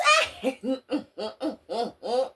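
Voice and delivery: loud burst of laughter; loud, giggling laugh. Real, not acted. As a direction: crack up at your own singing. Cracks up after joke song.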